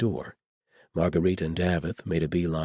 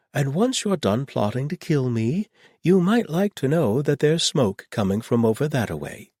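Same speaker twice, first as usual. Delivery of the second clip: a lightly voiced sardonic man, higher; dry Davith voice; 10 semitones higher, 6 dB louder than usual. Davith teasing the plotters about his murder, in a lightly higher dry voice.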